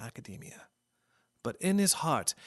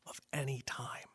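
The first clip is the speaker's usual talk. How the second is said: hushed whisper; 9 dB quieter than usual. Acted. Dramatic radio introduction.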